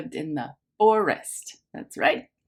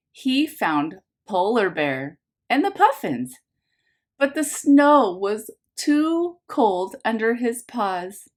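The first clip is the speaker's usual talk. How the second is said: bright storybook read-aloud, a little louder; lively picture-book narration for a class; reading to children; 3 semitones higher, 3 dB louder than usual. A narrator's reading. Teacher reads picture book aloud.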